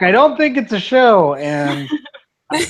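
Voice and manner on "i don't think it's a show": high, loud acted retort; yelling back. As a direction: yell it back across the distance. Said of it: "I'm gonna yell back".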